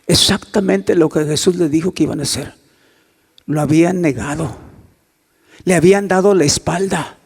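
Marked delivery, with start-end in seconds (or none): none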